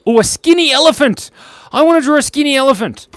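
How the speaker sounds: high, loud burst of eagerness; excited. Real, not acted. Streamer spotting a drawing request, high and excited. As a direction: pipe up loudly with delight.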